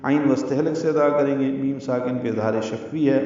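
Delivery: ordinary talk, nothing marked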